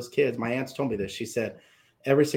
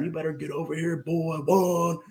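threatening dad; 4 semitones higher than usual. Retold or imitated. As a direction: count it down like an angry father. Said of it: "I can hear him".

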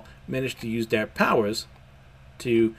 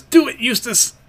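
much higher and louder call; a fired-up cry to his ally; climactic shout; 12 semitones higher, 7 dB louder than usual. Acted. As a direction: yell the go signal.

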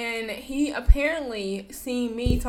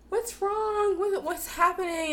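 much higher, concerned teacher's questions; worried teacher; 9 semitones higher than usual. Retold or imitated. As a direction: voice the art teacher's alarmed concern. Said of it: "and she's like".

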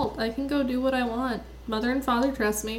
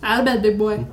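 loud wake-up call; 4 dB louder than usual. Acted. Rousing sleeping brother.

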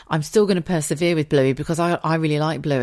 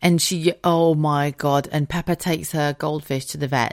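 ordinary talk, nothing marked

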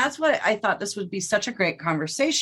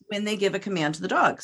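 ordinary talk

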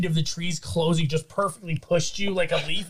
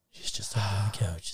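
soft lower whisper; 7 semitones lower, 8 dB quieter than usual. Acted. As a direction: drop into a hushed aside. Joking aside about the scene.

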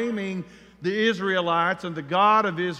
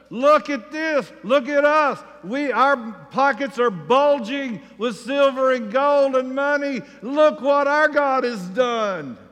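higher, louder bragging; cocky; 5 semitones higher, 4 dB louder than usual. Retold or imitated. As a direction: strut and show off the loot. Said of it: "they're kinda cocky".

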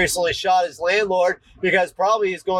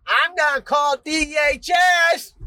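put-on a kid shouting a threat to call DHS at a parent; bratty kid threat; 9 semitones higher than usual. Kid threatening parent with DHS, high mock shout. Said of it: "they're gonna be like".

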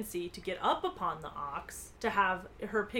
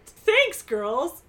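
high, loud opening burst; relieved thanks; 6 semitones higher, 8 dB louder than usual. A narrator's reading. Nancy thanks her friends after the ride, exclaiming. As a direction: exclaim it, breathless mock relief.

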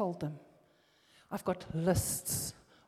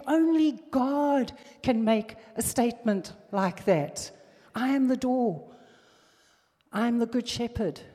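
higher, louder, slowed for emphasis; full-force preaching; 5 semitones higher, 7 dB louder than usual. Acted. Preacher proclaiming Jesus' I am statements, loud and rising. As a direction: hammer each claim home.

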